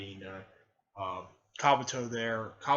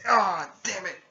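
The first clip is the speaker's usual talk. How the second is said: loud, raised-pitch yelp and curse; mock cry of pain; 7 semitones higher, 11 dB louder than usual. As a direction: curse as the blow lands. Character is hit in the legs.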